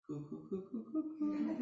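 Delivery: put-on doting adult cooing to a baby; baby talk cooing.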